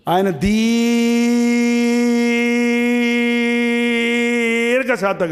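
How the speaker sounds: drawn-out word. Shown as a demonstration. Preacher showing God's long patience by stretching దీర్ఘశాంతం very long. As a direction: hold దీర్ఘశాంతం until the breath runs out. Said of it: "ఇంకా నాకు దమ్ము లేదు, మీకుంటే లాగండి".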